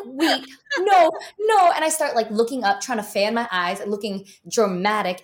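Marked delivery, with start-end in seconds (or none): 0.1-1.7 higher and louder, flustered; panicked no. Retold or imitated. Eyes watering too early in the audition, panicked. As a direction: plead with your own eyes to stop. Tears starting before scene.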